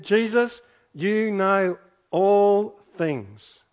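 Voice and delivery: loud, very slow, drawn-out proclamation; emphatic declaration. Acted. Preacher voicing Peter's surrender to Jesus, loud emphatic declaration. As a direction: proclaim it to heaven, stretching each word.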